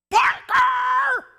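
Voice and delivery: full-force high shout, name stretched out; long, loud re-enacted call; yelling for the dog. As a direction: holler after a runaway dog. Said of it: "you'd hear me yelling".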